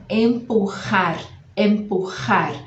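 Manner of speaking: Empurrar with the j scraped from the throat; throaty jota. Shown as a demonstration. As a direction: push the rr sound from the back of the throat. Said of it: "esse som que parte aqui da garganta".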